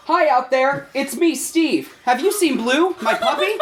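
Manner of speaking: a lightly voiced children's TV host; Steve voice. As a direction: sing it out like a children's show host. Steve character voice in reading.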